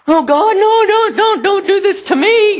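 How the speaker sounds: mock-whining Moses begging God; pleading Moses. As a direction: beg God in a high whiny Moses voice. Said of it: "Moses had the same story".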